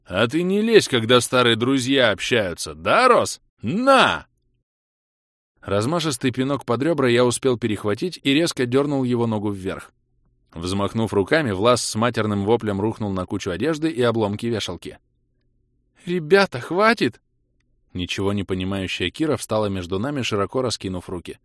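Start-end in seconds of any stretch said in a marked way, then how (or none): 0.1-4.3 loud, raised brawler's voice; taunt, then shout on the blow; aggressive taunt. Acted. Vlas warning Kira off as he fights, aggressive shout. Brawler warns off woman, strikes. 16.1-17.2 high, raised female voice; alarmed plea. Acted. Kira stepping between two fighting friends, high alarmed plea.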